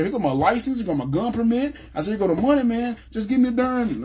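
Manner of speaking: high-pitched insistent push, offering license and money; exasperated demand. Retold or imitated. Customer arguing with the gun store clerk, exasperated and high. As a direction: shove the papers at him, fed up.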